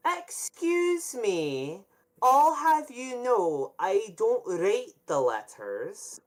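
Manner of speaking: high, slow, huffy voice; offended high lady; indignant stewardess. Acted. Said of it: "her behind you go".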